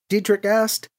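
plain reading voice

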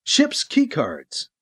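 plain reading voice

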